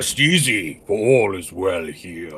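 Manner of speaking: big, deep dragon voice; Vrtra. Acted. Player answers the call for a dragon, deep and grand. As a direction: resonant dragon offering reassurance. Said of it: "Big, deep dragon voice".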